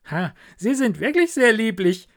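lightly voiced Hans, high and pleased; eager little Hans. Hans admiring his primroses, eager.

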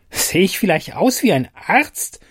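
a tiny fairy snapping back; angry fairy. Acted. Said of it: "gab die Fee erbost zurück".

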